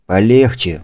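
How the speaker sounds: usual speaking voice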